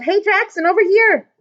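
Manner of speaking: high, loud call across rows; loud high yell. A narrator's reading. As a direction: call the vendor over. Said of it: "a lady yelled".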